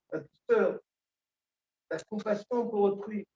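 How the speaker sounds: ordinary talk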